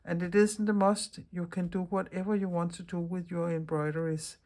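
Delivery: normal talking voice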